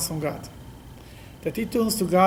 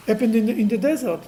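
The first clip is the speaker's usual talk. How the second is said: ordinary talk, nothing marked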